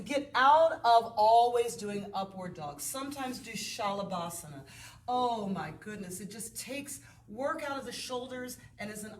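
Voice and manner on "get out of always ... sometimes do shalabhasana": insistent. Real, not acted. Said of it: "Now listen".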